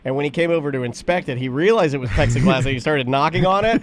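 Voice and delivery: ordinary delivery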